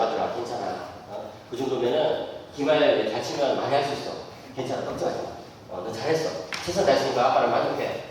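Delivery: soft, low, reassuring dad; a father quietly consoling his son; comforting father. Retold or imitated. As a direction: gently soothe a crying son.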